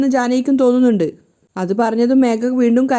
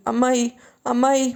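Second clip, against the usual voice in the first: slow, drawn-out tearful call for auntie; mock crying call; slower than usual. A narrator's reading. Megha comes to her aunt, lightly voiced tearful calling. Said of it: "മേഘ കരഞ്ഞുകൊണ്ടാണ് ജാനകിയുടെ മുറിയിലേക്ക് വന്നത്".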